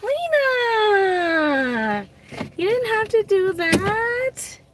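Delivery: high, surprised cry of the name and thanks; thrilled, gushing thanks to Lena; excited thanks. Real, not acted. Thanking a viewer for a gift.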